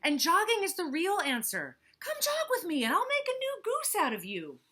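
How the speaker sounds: high, loud, pushy hippo selling jogging; eager Hippo. Acted. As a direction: sell it hard like a fitness nut.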